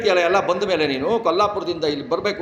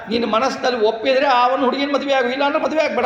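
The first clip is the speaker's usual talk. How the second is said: much higher, frank grandson teasing grandpa; higher familiar retort; Rudra's blunt retort; 9 semitones higher, 3 dB louder than usual. Acted. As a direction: toss it back boldly, pitched high.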